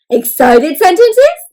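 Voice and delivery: high, loud, stretched-out enthusiasm; big excited delivery. Shown as a demonstration. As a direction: say it like an exclamation. Demonstrating excited sentence type.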